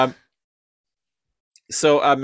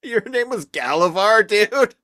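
stoner voice; 12 semitones higher, 4 dB louder than usual. Retold or imitated. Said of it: "being like".

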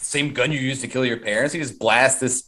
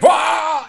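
high cartoon wail; mock wail; 3 dB louder than usual. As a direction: let out a silly high cry. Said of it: "he's like".

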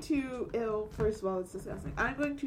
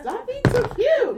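excited; 13 semitones higher, 14 dB louder than usual. Real, not acted. Player squeals at GM.

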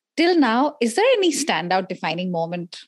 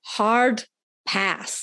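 put-on American accent. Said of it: "I'm gonna put on my best American accent".

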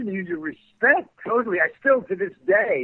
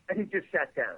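ordinary talk, nothing marked

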